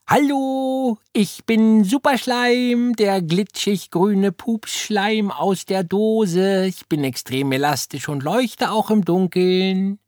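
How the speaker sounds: high, slow squeaky toy-slime voice; squawking slime creature. A narrator's reading. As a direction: squawk it out like a talking blob of slime. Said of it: "quäkte". (quäkige, hohe Schleimmonster-Stimme)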